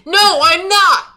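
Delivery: loud, drawn-out protest; indignant shout. Real, not acted. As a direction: yell the denial, offended. Defensive shout at accusation.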